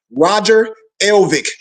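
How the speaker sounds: Roger Elvick said slowly, every syllable clear; slow dictation. Shown as a demonstration. Dictating the name to the audience, slow and clear. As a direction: spell out "Roger Elvick" slowly for note-taking. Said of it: "Write it down".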